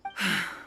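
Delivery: a long, tired sigh before the story; weary sigh. Acted. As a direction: let out a heavy sigh, worn down. Wife begins a sad story.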